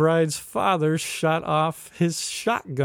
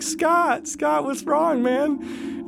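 much higher, mock-concerned calling; imitating worried guests; 12 semitones higher, much slower than usual. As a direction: call after a panicking friend, pitched way up. Wedding guests calling to calm him.

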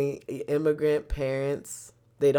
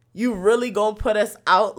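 incredulous; 6 semitones higher, 8 dB louder than usual. Retold or imitated. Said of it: "I'm like".

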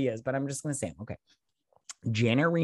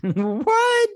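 shocked what; 6 dB louder than usual. Real, not acted. Shocked by yeast-infection remark.

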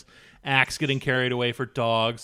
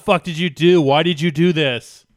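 Marco; 4 semitones higher, 7 dB louder than usual. Acted. Acts Marco berating Jake.

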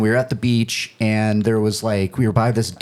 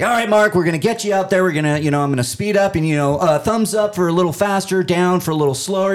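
high, upbeat instructor voice; brief the kid cheerfully before skiing; camp counselor; 7 semitones higher, faster than usual. Retold or imitated. Counselor coaching a kid in the water, high upbeat instructions. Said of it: "He's like".

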